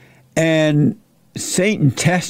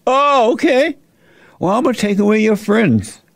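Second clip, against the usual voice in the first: Satan gloating to Job over his losses; taunting Satan; 6 semitones higher than usual. Acted. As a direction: sneer at Job like the devil. Satan threatens Job next.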